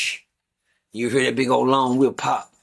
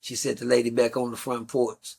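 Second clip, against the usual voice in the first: ordinary talk, nothing marked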